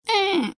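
high whiny mock sob; a squeaky fake whimper, eh; Snooki cry. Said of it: "the Snooki cry".

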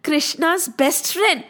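ordinary delivery, nothing marked

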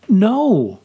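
a loud, high exclaimed "No!" with a voice crack. A narrator's reading. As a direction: blurt out "No!" too loudly, voice cracking. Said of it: "I exclaimed".

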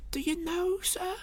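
falsetto landlady voice; Missus Hudson, confiding. Acted. Landlady begins confiding to the gentleman, falsetto. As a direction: lean in, very high-pitched.

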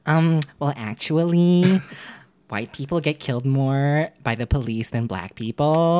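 slow smug well-actually voice; drawn-out condescending correction; internet troll voice. Acted. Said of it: "That's internet troll voice".

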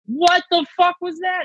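high, stunned disbelief; shocked outburst. Retold or imitated. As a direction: blurt it out in total confusion. Confused outburst backstage.

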